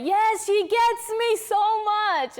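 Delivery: high, slow, swooning sigh of a fan; mock heartbroken fan. Cohost mimicking sad [name] fans, swooning high. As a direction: gush like a sad fan who feels seen. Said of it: "we're like".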